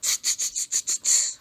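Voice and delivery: whispered tsk tsk chatter; monkey tsking. Acted. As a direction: tut like a monkey. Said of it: "and said".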